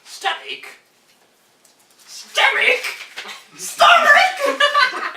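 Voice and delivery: high exclamations building to a shout; escalating incredulity. Acted. Doctor's incredulous repeats grow, ending in laughter.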